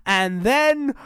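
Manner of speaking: ordinary delivery, nothing marked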